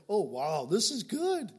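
high, loud, amazed delight; excited wonder. Pretend. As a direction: gush like you just saw a miracle. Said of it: "I'd be".

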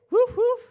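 woof woof cheer. Acted. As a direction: bark it out like an eighties crowd. Cheering on the Gen Xers.

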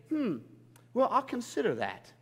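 hammed-up hesitant consideration; mock pondering. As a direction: pretend to weigh up a request. Mimicking a noncommittal God.

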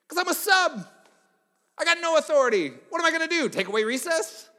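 very high, loud, mock-cheery sarcasm; sarcastic sub. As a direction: gush fake enthusiasm. Sub sarcastic to YouTube kid.

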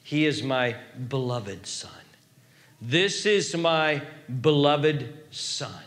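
voice of God. Acted. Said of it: "notice what he says next".

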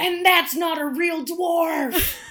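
high, slow, full-volume yell; mock outraged heckler. Joining in as the angry school crowd, mock outraged shouting. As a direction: holler it like a scandalised audience member.

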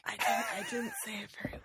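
hushed whisper. Acted. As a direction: whisper it secretly. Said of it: "Why are we whispering?".

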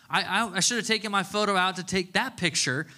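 ordinary delivery

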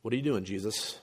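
soft, low mock disapproval; disapproving thought. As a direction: quietly voice a reader's misgivings. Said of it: "their immediate thought would have been".

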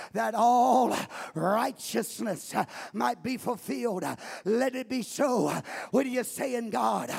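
loud fervent preaching; preaching at full force. Acted. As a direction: preach it, building up. Preaching with scripture.

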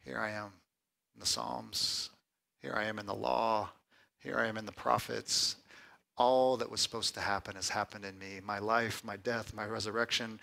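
soft, low, measured voice; hushed reverence. Acted. Preacher voicing Jesus present in all scripture, hushed. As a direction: let each phrase land quietly, with pauses.

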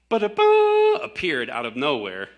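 loud, high mocking ba-da-ba. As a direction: scoff at it like a magic trick. Mocking Dawkins' theory.

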